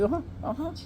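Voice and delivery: high, soft mimicked agreement; mock female voice. Girl promising to be quiet, mock high female voice. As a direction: squeak out a light, compliant girl's reply.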